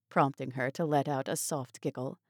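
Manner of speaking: plain reading voice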